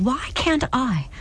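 anguished, drawn-out demand. A narrator's reading. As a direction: demand it, drawing out the words. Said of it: "Clara demanded".

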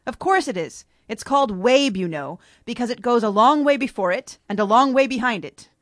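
lightly voiced pompous Humpty; mild lecturing Humpty voice; Humpty Dumpty. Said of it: "Humpty Dumpty ... he responds".

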